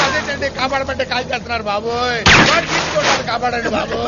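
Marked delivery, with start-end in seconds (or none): none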